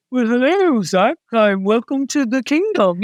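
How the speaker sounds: mock-grand, stately voice of God. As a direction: intone a lofty heavenly welcome, hammed up. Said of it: "people think God says".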